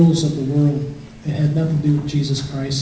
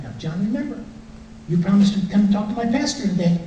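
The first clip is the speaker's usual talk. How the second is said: higher, sweet nagging wife voice; a wife's gentle reminder, pitched up; imitating wife; 6 semitones higher than usual. Wife reminding John of his promise, pitched up and sweet.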